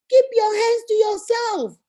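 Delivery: slow, high schoolteacher rule; teacher to children. Shown as a demonstration. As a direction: recite it like a preschool teacher. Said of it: "This is what we tell children in the school".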